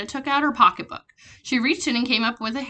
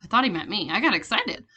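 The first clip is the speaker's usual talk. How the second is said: plain reading voice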